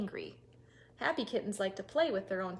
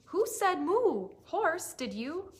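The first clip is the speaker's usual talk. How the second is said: curious question; 6 semitones higher, 5 dB louder, slower than usual. Acted. Storyteller questions animals.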